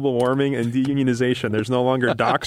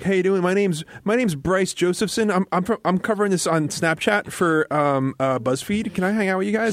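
higher, eager rookie voice; eager young reporter; 8 semitones higher than usual. Retold or imitated. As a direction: play a keen young newcomer.

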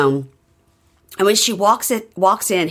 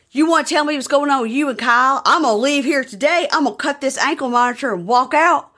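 high, heated mock tirade; imitated indignant partner in a quarrel; acted ultimatum; 6 semitones higher than usual. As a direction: lay down a pretend threat, pitch held up. Acting out her threat to leave.

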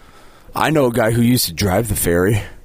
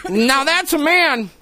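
mocking high voice; 11 semitones higher than usual.

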